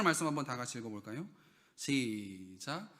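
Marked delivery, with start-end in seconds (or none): none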